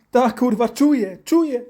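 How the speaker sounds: high, loud pained cries; hammed-up frantic yelping; panicked child. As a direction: insist desperately that it hurts. Child in pain says he feels it.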